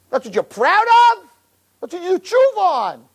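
indignant rebuke. Real, not acted. Rabbi scolds the congregation, high and outraged.

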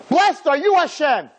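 high, loud burst of amazement; astonished exclamation. Acted. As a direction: call it out as if it's unbelievable. Astonished translation of the blessing.

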